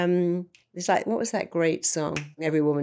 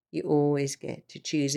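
ordinary talk

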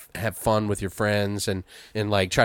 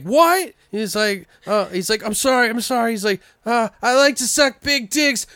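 very high, flustered nerd voice; scared nerd apologizing; timid nerd; 12 semitones higher than usual. Retold or imitated. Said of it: "and he's like".

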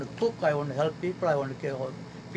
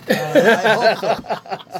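bursting into a loud, high laugh; 5 semitones higher, 11 dB louder, much slower than usual. Real, not acted. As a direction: laugh it off, much louder and higher. Joking Bernie might come Saturday.

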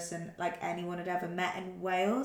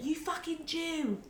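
retold mock yell; 9 semitones higher, 4 dB quieter, slower than usual. Her mate yelling at a footballer, a mild mock yell. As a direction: pitch the slur up like a retold stadium shout. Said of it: "one of her mates just shouted".

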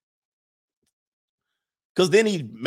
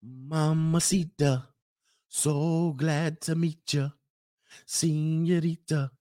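slow, softer crooning flirt; mock smooth flirt; 3 dB quieter, much slower than usual. Man sweet-talking a woman abroad, slow cheesy crooning. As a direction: draw it out like a cheesy charmer. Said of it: "You got them out here like".

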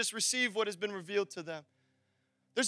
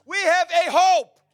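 high, loud, drawn-out declaration; emphatic proclamation; 7 semitones higher, 9 dB louder, slower than usual. Real, not acted. Preacher retrying line to rouse church, emphatic proclamation. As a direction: proclaim it slowly, leaning on every word. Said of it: "Let me try that again".

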